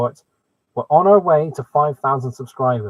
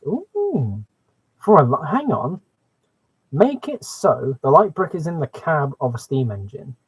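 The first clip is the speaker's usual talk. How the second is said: usual speaking voice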